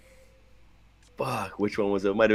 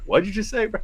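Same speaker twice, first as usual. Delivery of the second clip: much higher incredulous squeak; mock disbelief; 13 semitones higher than usual. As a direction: act shocked at the teasing. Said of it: "And you're like".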